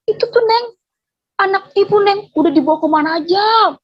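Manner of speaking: high-pitched acted mother's voice; imitated mother. Mother telling the new neighbour about her child, high imitated voice.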